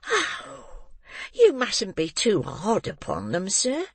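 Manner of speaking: wheedling matron coaxing the beadle; coaxingly. Acted. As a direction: coax him gently, drawing it out. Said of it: "said Missus Mann coaxingly".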